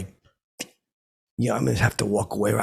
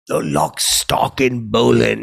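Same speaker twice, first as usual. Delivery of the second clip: loud, slow announcer punchline; loud announcer punchline retold; loudspeaker punchline; 7 dB louder, slower than usual. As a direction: boom the punchline like the PA. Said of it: "I'd do the impression".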